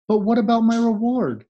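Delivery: high, worried plea for payment; anxious call. A narrator's reading. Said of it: "called the crane anxiously".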